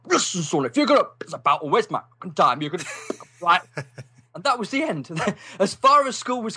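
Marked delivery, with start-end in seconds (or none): 0.0-3.6 retold angry blow-up. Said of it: "he got, blew up".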